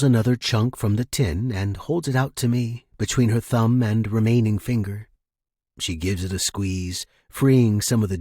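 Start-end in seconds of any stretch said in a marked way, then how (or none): none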